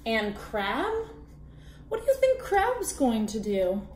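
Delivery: usual speaking voice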